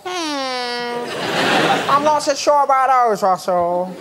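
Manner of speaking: lightly imitating a Taiwanese accent; a hint of a Taiwanese man doubting the slogans; mock Taiwanese accent. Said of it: "doing this voice".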